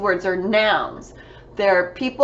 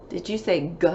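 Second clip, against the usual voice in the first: normal talking voice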